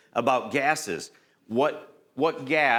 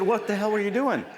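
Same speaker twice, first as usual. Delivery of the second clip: higher, pushed exasperated demand; retold exasperated question; 10 semitones higher than usual. Trainer retells scolding Brad, higher mock exasperation. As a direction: act out grilling the trainee, a mock angry snap. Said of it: "I said".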